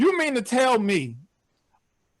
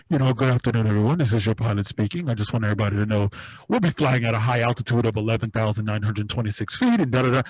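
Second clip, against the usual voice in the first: much lower, smooth captain announcement; smooth pilot voice; 13 semitones lower, faster than usual. Retold or imitated. Pilot announcing altitude to passengers in a deep smooth voice. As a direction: drop deep like a reassuring airline captain. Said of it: "the pilot comes on like".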